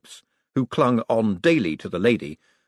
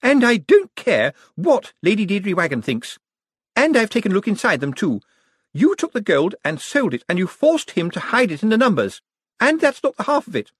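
much higher, louder accusing voice; Miss Drapes; 11 semitones higher, 5 dB louder than usual. Acted. Miss Drapes accusing Cosmo publicly, high and loud. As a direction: accuse him to his face, red with anger.

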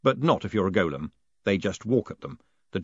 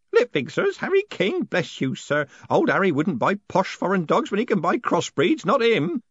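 put-on high, incredulous old postman; scoffing old Groat; 9 semitones higher than usual.

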